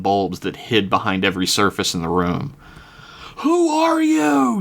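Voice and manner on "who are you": high, slow, terrified shriek; terrified scream. Acted. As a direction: scream it out in terror. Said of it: "she screamed out".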